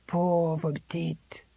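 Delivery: slow, resigned sigh of pity in French; old servant pitying the girl, slowly; sad resignation. Acted. Old Zélie pitying Miranda, sadly resigned. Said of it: "She spoke with a sort of sad resignation".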